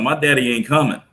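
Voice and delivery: put-on a boy shouting that his father will not go; son yelling. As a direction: cry it out to stop the deal. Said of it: "my son yells out".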